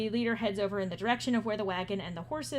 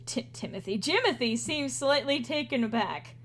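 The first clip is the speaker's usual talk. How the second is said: ordinary talk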